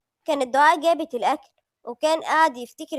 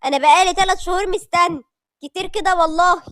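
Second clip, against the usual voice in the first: higher, louder, emboldened plea; bold declaration; 4 semitones higher, 7 dB louder than usual. A narrator's reading. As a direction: blurt out the proposal with courage. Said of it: "أمجد بشجاعة رد عليه". (بشجاعة واندفاع)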